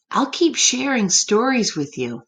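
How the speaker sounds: plain reading voice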